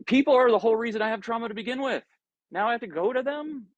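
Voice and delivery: high-pitched, incredulous objection; indignant protest. Acted. Speaker objects to letting people into their heart, indignant and high. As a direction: rush out the complaint, pitched right up. Acts out own protest at advice.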